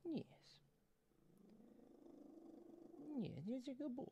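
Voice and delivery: quiet cooing to a cat; soft pet talk. Real, not acted. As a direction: coo gently at the purring cat.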